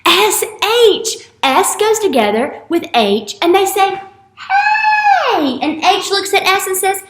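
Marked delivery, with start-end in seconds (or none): 4.4-5.6 high, loud cheerful "Hey!"; bright greeting shout; excited shout. Acted. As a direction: call out like a happy letter. Letters greeting each other, shouting.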